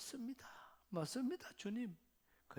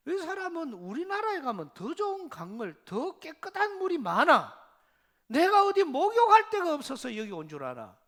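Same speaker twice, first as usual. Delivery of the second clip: louder, scornful protest; a proud general's loud, huffy retort; indignant general; 7 semitones higher, 8 dB louder than usual. Retold or imitated. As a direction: scoff at the muddy river like a proud general. Naaman scoffs at the river.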